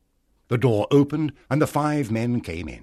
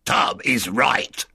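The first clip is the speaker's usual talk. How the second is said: rebel pirate; 2 semitones higher, 4 dB louder, slower than usual. A narrator's reading. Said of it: "said another pirate".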